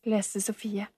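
ordinary delivery

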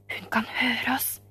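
breathy, unvoiced, slow whisper. A narrator's reading. Boy whispering a warning to the gloved man. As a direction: hush it so the lady cannot hear. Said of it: "hvisket Oliver".

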